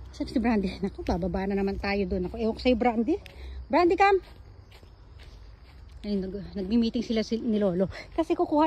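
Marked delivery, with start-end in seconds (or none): 3.7-4.2 loud, high call; calling the dog. Real, not acted. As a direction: holler for your dog to come. Calling her dog to come.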